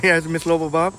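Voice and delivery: ordinary talk, nothing marked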